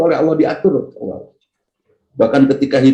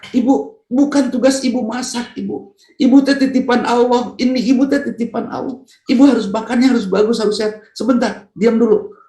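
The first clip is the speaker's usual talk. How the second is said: much higher, doting husband voice; husband praising his wife, voice pitched high; 9 semitones higher than usual. Acted. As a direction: pitch way up and fuss over her like a husband.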